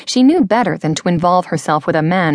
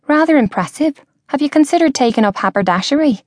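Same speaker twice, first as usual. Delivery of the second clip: put-on higher, quick, playful young woman; teasing young woman; 6 semitones higher, faster than usual. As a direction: tease him lightly and fast. Georgia teases him.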